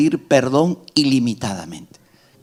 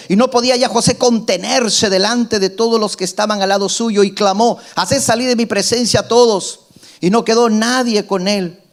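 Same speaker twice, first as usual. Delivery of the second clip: raised, declaiming Bible reading; scripture reading; 7 semitones higher than usual. Acted. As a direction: proclaim the verses to the congregation. Reads scripture aloud in sermon. (lectura bíblica proclamada, más aguda)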